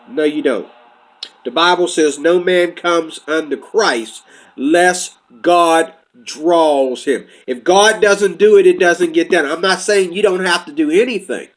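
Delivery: usual speaking voice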